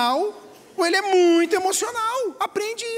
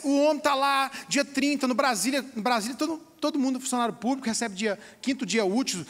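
usual speaking voice

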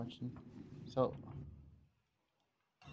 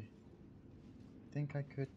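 slow hushed whisper; 16 dB quieter, much slower than usual. Real, not acted. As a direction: barely breathe the words. Hiding, sneaking past.

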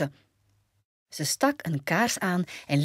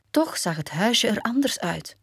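ordinary delivery, nothing marked

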